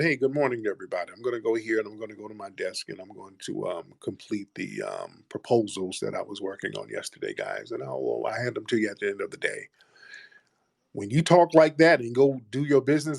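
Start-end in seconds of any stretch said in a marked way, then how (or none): 0.0-9.7 softer, rushed mock low-key brother; quiet reserved worker. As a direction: tell it fast and hushed, just doing your job. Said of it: "a brother who's just kinda quiet".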